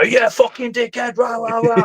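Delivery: high-pitched mock ranting; mock arguing. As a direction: imitate someone ranting in a row. Imitating anyone else in an argument.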